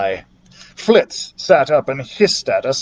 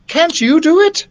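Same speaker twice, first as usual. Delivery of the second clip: put-on young boy voice; 13 semitones higher than usual. A narrator's reading. Young narrator asks.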